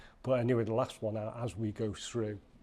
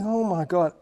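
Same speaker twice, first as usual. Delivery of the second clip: panicked thought; 7 semitones higher, 7 dB louder than usual. Retold or imitated.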